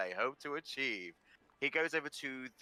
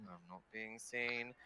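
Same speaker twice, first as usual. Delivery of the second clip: hiding whisper-like mutter; 9 dB quieter than usual. Retold or imitated. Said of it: "she's definitely doing the".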